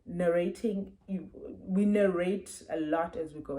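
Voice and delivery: usual speaking voice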